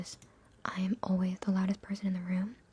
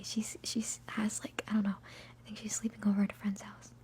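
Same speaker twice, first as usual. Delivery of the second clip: usual speaking voice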